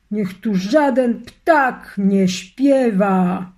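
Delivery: loud, slow, booming commands of an evil sorcerer; evil wizard bellowing at his lightning; wizard's war cry. A narrator's reading. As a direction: roar the orders like thunder over a burning forest.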